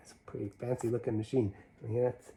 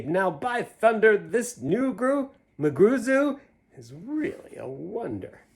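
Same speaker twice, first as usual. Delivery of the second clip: amazed crowd; 10 semitones higher, 7 dB louder, slower than usual. Retold or imitated. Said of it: "they will say".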